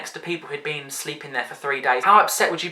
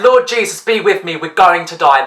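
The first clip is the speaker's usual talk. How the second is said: desperate prayer; 2 semitones higher, 6 dB louder than usual. Retold or imitated. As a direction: pray in mock panic. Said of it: "I'm just looking up like".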